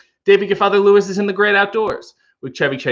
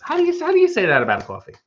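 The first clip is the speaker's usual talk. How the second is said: incredulous; 11 semitones higher, much faster than usual. Real, not acted. Mock outrage at coffee label.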